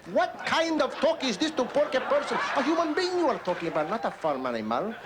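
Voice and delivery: high, scandalized protest; mock outrage. Comedian objecting to crude slang, mock outraged. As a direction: pitch it way up, appalled.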